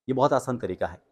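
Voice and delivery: ordinary talk, nothing marked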